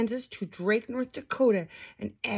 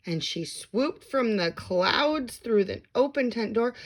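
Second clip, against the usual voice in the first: usual speaking voice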